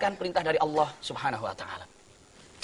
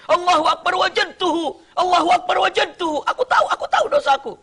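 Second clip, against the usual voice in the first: tearful realization; 9 semitones higher, 3 dB louder than usual. Acted. Imam cries realizing sin.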